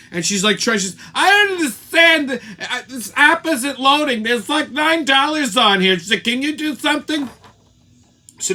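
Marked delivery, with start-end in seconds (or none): 1.1-6.0 mocking high, slow whiny voice; mocking clueless girl. Mimics the chinless girl at checkout. 6.2-7.3 mocking clueless girl. Mimics the chinless girl asking cashier.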